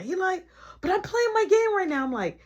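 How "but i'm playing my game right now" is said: put-on high, protesting kid voice; mock kid complaint; whiny kid. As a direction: whine like a kid. Little brother voiced protesting.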